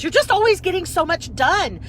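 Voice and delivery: high, loud admiring gush; the fan admiring her productivity, high and loud. Retold or imitated. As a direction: praise her like a thrilled fan. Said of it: "And she goes".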